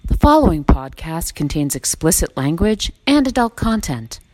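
announcer-style disclaimer read. Acted. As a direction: read it like a legal notice. Reads a podcast disclaimer.

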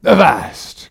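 loud stern captain's order; commanding shout. Acted. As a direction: bark the order to stop. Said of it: "cried Ahab".

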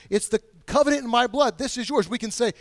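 ordinary delivery

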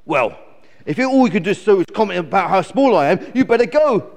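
loud, fast indignant eagle; huffy eagle telling off a visitor; squawking. Acted. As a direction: build to a high, cross squawk. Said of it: "squawked Glen".

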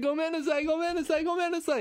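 high-pitched repeated pleading, imitating a pupil; frantic apology. Boy dragged down the hall apologizes, frantic high squeal. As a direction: plead in a squeaky rush of sorries. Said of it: "trying to apologize".

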